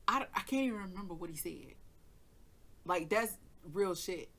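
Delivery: usual speaking voice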